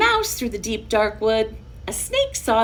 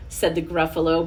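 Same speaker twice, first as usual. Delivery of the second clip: ordinary delivery, nothing marked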